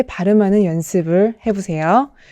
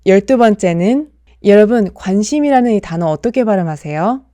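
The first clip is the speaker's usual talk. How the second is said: usual speaking voice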